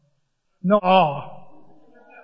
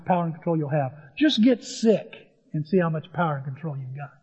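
normal talking voice

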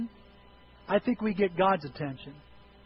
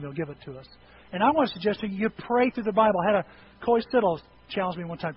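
ordinary delivery

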